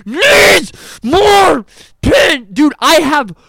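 slow, loud, high mocking wail of the catchphrase; drawn-out yelled taunt at a teammate; mock shout. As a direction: drag it out loud to mock him.

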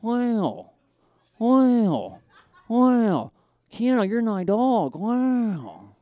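high, drawn-out wows; Owen Wilson. Acted. Actor friend greets his pal at the show, high drawling impression. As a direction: swoop through each slow word in an actor impression. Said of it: "his friend Owen Wilson to join".

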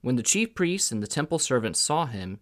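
ordinary delivery, nothing marked

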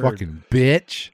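slow, drawn-out insult; emphatic contempt. Real, not acted. Host capping his rant about a celebrity, emphatic contempt. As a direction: spit it out slowly, word by word.

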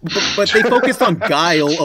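ordinary delivery

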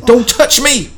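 high, loud, sudden outburst; shouted warning. Acted. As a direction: shout it as a dramatic threat. Acts killer warning her off.

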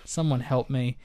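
normal talking voice

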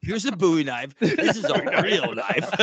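a hammed-up Crocodile Dundee style Australian accent; mock Australian bravado with laughter; bad Australian accent. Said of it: "I have terrible accents".

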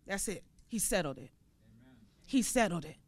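soft, low, settled; hushed certainty. Real, not acted. Preacher settling the point softly for the church. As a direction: drop to a quiet conviction. Quiet settling after climax.

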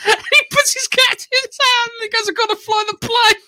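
very high, squeaking through laughter; helpless laughter. Real, not acted. Telling co-host the drunk pilot sketch, helplessly laughing. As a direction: struggle to get the words out while cracking up.

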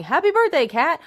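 high, chirpy birthday wish; mock cheerful birthday hello; sing-song birthday greeting. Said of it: "staring at me and going".

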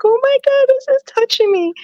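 high, moved exclamation; mock tearful gush. Mom feeding son, retelling being moved to tears by gospel song. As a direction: gush like the song broke you. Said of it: "I start crying".

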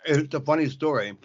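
talking while chewing ice; words round a mouthful of ice. Real, not acted. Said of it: "Sorry, I'm chewing ice".

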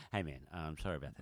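low, soft, rushed apology; sheepish apology. Retold or imitated. Imitating his mate calling back after blowing up, sheepish. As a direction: mumble a quick sorry, imitating a mate.

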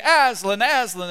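loud excited calling of the name; chanting his name in a husky, creaking crowd voice. A narrator's reading. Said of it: "in their various husky and creaking, wave-like voices".

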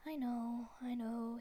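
plain reading voice

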